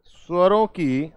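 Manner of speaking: word by word, long pauses between; slow dictation. Shown as a demonstration. Teacher dictating the first point to students, slow and spaced. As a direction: dictate slowly so students can write. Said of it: "पहला point क्या हो जाएगा?".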